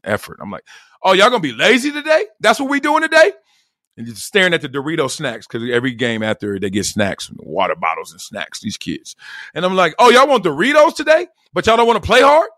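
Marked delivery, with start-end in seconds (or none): 1.0-3.3 raised, high-pitched challenge; mock-indignant rhetorical questions, pitched up; scolding coach. Coach calling out lazy kids, scolding. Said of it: "turned the bass up on their ass". 10.0-12.5 loud, high taunting questions; scolding coach. Retold or imitated. Coach to kids staring at Dorito snacks, scolding. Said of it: "turned the bass up on their ass".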